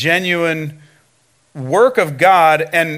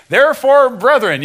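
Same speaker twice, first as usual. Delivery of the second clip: raised, louder preacher's address; Paul addressing brethren; 6 semitones higher, 4 dB louder than usual. Acted. Paul showing up before his listeners, raised preacherly address. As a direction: lift it as if hailing the brethren. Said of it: "Paul shows up and he".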